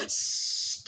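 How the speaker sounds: long, soft "shh"; shushing. Retold or imitated. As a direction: imitate students hushing the class. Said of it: "ada yang ngelihat terus". (desis "sst" pelan dan panjang menirukan mahasiswa)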